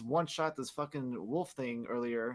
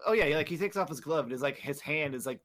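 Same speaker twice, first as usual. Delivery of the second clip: normal talking voice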